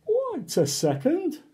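high, sudden wait a second; suspicious surprise. Acted. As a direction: catch on to the trick. Reader suspects trick.

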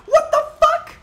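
high, loud laughing curse; laughing shriek. Real, not acted. Player stunned by a hit, laughing shriek.

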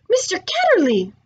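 high, loud cry of sudden recognition; shouted out. A narrator's reading. Two children suddenly remembering each other, shouted out. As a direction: call it out as memory suddenly returns. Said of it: "they both shout out".

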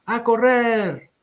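high, long-drawn command; loud shout. A narrator's reading. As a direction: yell it like an urgent call to run. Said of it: "El reloj grita".

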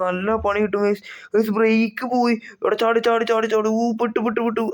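high panicked cries as the brakes fail; panicked yelling. Acted. Brakes fail, bike crashing down. (Break പോയ പരിഭ്രാന്തിയിൽ ഉയർന്ന നിലവിളി)

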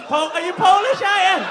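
loud, high, incredulous "are you Polish"; shouted question. Real, not acted. Comedian shouts at the crowd asking if he's Polish, loud. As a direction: bellow it across the room.

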